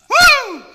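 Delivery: high loud whoop; excited whoop. Retold or imitated. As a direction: let out a joyful woo. Jump celebration.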